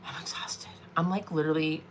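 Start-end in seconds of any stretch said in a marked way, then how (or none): 0.1-0.7 exhausted whisper. Real, not acted. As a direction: breathe it out, drained. Worn out after rushing.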